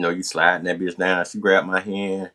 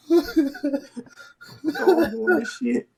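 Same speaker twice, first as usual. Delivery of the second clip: laughing; much slower than usual. Real, not acted. Laughing, stretched.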